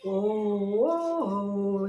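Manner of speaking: deep, forced from the throat; throaty singer's ohs; heavy throat voice. Shown as a demonstration. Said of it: "ભારે અવાજ કાઢો તો કેવો ગળેથી કાઢે".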